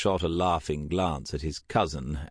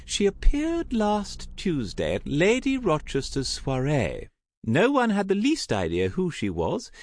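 high, swooping society lady; theatrical high-pitched gossip; effusive gossiping aunt; 10 semitones higher, 3 dB louder than usual. Acted. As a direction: gush with relish over every detail. Voices gossiping Aunt Tessa.

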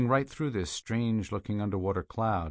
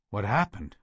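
stunned; 4 dB louder than usual. A narrator's reading. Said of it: "Peters gawked".

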